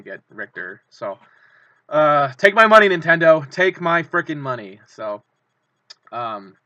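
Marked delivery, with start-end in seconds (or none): 1.9-4.8 loud, high, eager cry to Nintendo; excited shout. Real, not acted. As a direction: shout it like you can't hold the cash back. Begging Nintendo to take money.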